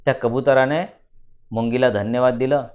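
ordinary delivery

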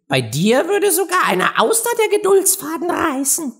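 put-on very high, squeaky young crab; somewhat snippy. A narrator's reading. Young crab answers the preachy parent, snippy. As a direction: complain pertly at a very high pitch. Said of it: "sagte der junge Krebs etwas schnippig".

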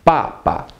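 "papa" falling sharply, then short and light; falling-then-light tones on "papa"; fourth tone then neutral. Shown as a demonstration. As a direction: drop hard on the first syllable of "papa", second unstressed. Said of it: "Fourth tone, then neutral".

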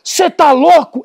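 high, slow mock outrage; stressed owner snapping at the dog; angry retort. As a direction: shout him down in disbelief. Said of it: "vem pra cima".

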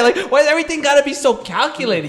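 high, laughing tease. Real, not acted. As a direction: tease him while laughing. Laughing jab at his friend.

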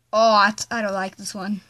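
ordinary delivery

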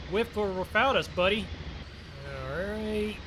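ordinary delivery

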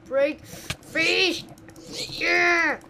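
loud high cheer; triumphant shout. Real, not acted. Escaping the place.

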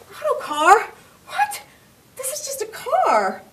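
high, sharp disbelief; puzzled outburst at the voice; baffled. Acted. Mary protests to the voice, high and baffled.

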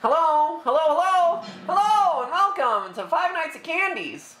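high, bright hello of a call recording; Phone Guy. Pretend. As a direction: greet like a cheery recorded caller. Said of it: "I just like playing phone guy".

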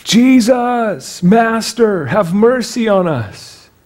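lifted voices. A narrator's reading. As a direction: cry out to the Master for mercy. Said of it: "they lifted up their voices".

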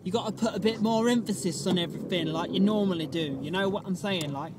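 overdone fake English lilt; fake English accent. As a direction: lean on every word in a put-on English accent. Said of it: "This is my fake English accent".

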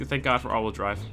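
usual speaking voice

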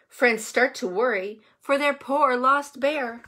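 usual speaking voice

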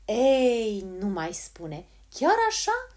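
put-on old woman's loud, incredulous exclaiming; amazed exclamation. A narrator's reading. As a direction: react with astonishment. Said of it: "exclamă bătrâna".

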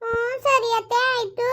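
put-on young wife meekly agreeing to mother-in-law; meek daughter-in-law.

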